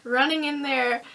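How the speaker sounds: usual speaking voice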